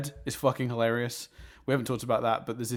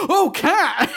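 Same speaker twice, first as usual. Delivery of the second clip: mock realization; 8 dB louder than usual. Firemen suddenly understanding.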